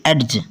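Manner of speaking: एड्ज with a separate d before the j; D wrongly pronounced. Shown as a demonstration. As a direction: sound out the D in एड्ज, as "ed-j". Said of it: "ना कि एड्ज".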